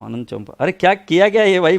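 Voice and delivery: ordinary talk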